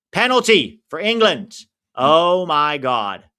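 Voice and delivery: loud, high burst of live reaction; excited shock. Real, not acted. Streamer reacting to England's penalty, loud and excited. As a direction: call out the penalty in amazement.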